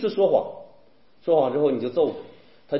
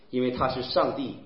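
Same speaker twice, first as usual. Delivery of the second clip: usual speaking voice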